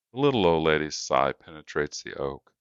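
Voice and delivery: ordinary delivery, nothing marked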